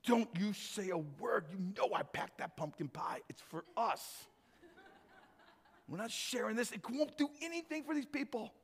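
hushed and conspiratorial, laughing to himself; sly little hush among greedy friends; secretive hush. Acted. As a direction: whisper it like you're hiding the pie from everyone. Stingy crowd hiding their food.

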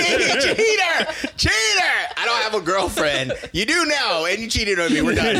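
high shrill mock-jilted shrieking; hammed-up wronged girlfriend, laughing; shrill sobbing outrage. Mock jilted lover scene.

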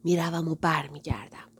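a hushed breathy whisper. Acted. Mother whispering in her daughter's ear. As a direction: lean in and whisper it. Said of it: "آهسته گفت".

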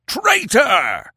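high, drawn howl of accusation; howled shout. Acted. Tribesmen cut Conan off with an accusation, howling. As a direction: howl it at the very top of the voice. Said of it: "The howl was like a dash".

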